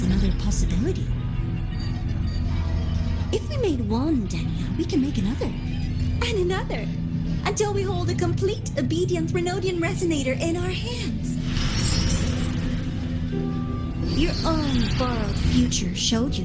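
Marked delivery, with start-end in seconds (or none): 5.5-11.4 grandiose villain. Acted. Villain proclaims a grand scheme.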